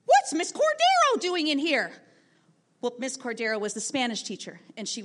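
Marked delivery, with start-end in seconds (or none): 0.1-1.9 sudden high, loud blurt across a silent room. Retold or imitated. Student blurts out during silent classwork, a high loud blurt. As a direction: shout it out of nowhere, high and loud. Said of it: "Jacob suddenly blurted out".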